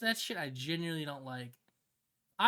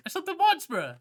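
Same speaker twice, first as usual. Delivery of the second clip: pitched-up whiny plea, higher than the first take; higher whine; 9 semitones higher, 7 dB louder than usual. Real, not acted. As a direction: plead it again in a higher whine. Higher whining repeat.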